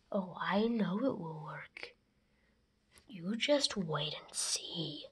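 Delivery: sly scheming whisper. Acted. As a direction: whisper it like a plotting villain. Plotting villain whispers to accomplice.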